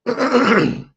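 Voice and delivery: clearing the throat mid-word; rough, throat-clearing start. Real, not acted. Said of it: "let me clear my throat".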